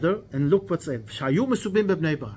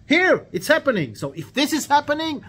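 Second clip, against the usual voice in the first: high, raised-voice conviction; excited proof; 10 semitones higher, 6 dB louder than usual. Acted. Akiva shows the rabbis the prophecy unfolding, excited conviction. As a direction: make the point triumphantly, pitched up and strong.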